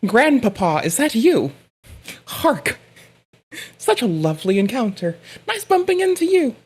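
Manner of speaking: put-on old-timey greeting. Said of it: "I'd be like".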